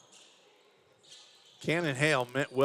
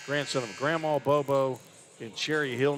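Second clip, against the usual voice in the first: ordinary talk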